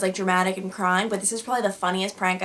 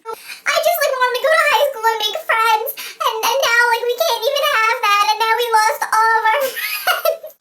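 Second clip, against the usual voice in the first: high, loud hammed-up wail; Victoria crying hysterically; 6 dB louder than usual. Friend mourns lost high school friends, mock hysterical sobbing. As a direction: imitate a friend bawling, voice far up high. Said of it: "she's hysterically crying".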